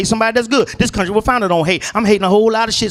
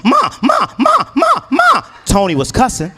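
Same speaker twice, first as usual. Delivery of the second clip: put-on high shrill child calling ma; calling out as a kid; 6 semitones higher than usual. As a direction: yell for mom like an eager little snitch. Child snitching, calling mom.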